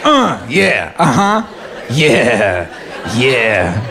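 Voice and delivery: a friend admiring himself mid-flex; lazy flexing Ryan. Retold or imitated.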